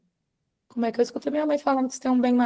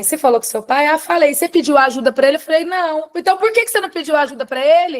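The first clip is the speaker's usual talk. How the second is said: loud, high back-and-forth; acted nagging exchange; 5 semitones higher, 6 dB louder, faster than usual. Mother pressing daughter about asking father for help, loud and insistent. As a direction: replay a rapid interrogation, exasperated.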